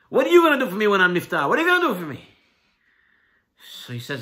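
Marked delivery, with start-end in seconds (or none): 0.1-2.3 loud, high, fast questioning; insistent demand. Acted. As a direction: press the last son hard for his answer. Father presses third son.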